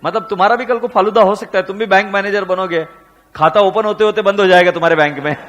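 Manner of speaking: usual speaking voice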